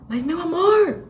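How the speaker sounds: high, loud scolding "meu amor"; mock-scold with a fake endearment; sweet words, angry tone. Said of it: "Não adianta chamar de amor, tá xingando". (bronca irritada disfarçada de carinho)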